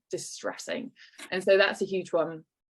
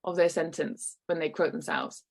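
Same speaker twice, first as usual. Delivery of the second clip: ordinary talk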